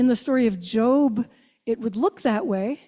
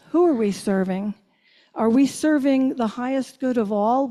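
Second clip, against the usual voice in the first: usual speaking voice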